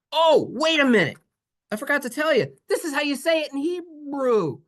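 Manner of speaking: a sudden oh, I forgot; sudden surprise. Real, not acted. Teacher suddenly remembers the Hebrew word, surprised.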